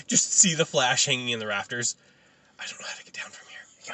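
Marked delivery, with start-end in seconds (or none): 2.6-3.9 hushed, helpless little whisper; mock whisper. Flash hanging in the rafters, helpless mock whisper. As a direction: whisper it as if stranded and embarrassed.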